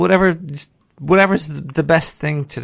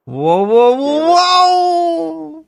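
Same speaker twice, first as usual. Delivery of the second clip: drawn-out whoas climbing steeply higher; amazed whoa; 11 semitones higher, 4 dB louder, much slower than usual. Real, not acted. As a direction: let each whoa swoop up in amazement. Reacting to food shown.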